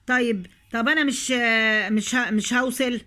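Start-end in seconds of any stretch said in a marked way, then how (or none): none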